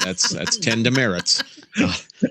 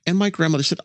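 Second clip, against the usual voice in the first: ordinary talk, nothing marked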